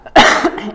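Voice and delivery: lautes Husten nach dem Verschlucken; Hustenanfall. Real, not acted. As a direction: heftig abhusten. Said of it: "ich hab mich verschluckt". (verschluckt und hustend)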